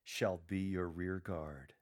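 slow, joyful answer. Shown as a demonstration. A lector answering his own question, slow joyful reply. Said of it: "then joyfully answer the question with".

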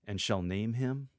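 rising question on him. Shown as a demonstration. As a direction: ask it, leaving it hanging. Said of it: "Convert the last line ... to a question".

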